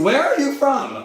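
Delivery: high, loud mock question; mock accent question. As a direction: play the interviewer teasingly. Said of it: "I'm from Westchester".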